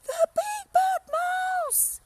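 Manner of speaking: high, slow, terrified scream; yell. Acted. Gruffalo's child screams at the giant shadow. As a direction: scream it in terror. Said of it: "yelled the Gruffalo's child".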